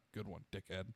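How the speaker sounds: quiet mock insult; under-the-breath retort. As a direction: say it low so the captain can't hear. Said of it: "I was like".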